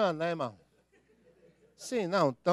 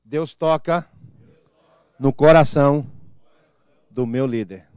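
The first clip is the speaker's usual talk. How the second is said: call and response; 4 semitones lower, 7 dB louder, slower than usual. Shown as a demonstration. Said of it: "Repitam após mim".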